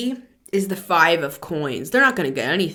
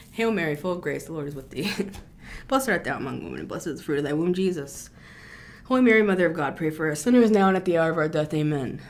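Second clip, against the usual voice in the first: recited prayer; 3 semitones lower, 4 dB quieter, much faster than usual. Acted. Recites the Hail Mary prayer.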